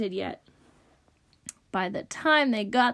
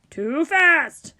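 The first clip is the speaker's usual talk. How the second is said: loud, raised objection; irritated rejection; 4 semitones higher, 6 dB louder than usual. A narrator's reading. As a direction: shout down the music. Rich dude rejects another piece.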